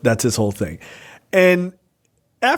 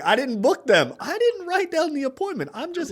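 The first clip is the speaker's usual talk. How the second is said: whiny excuse; 11 semitones higher, 4 dB quieter than usual. Retold or imitated. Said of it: "I didn't, I didn't do anything".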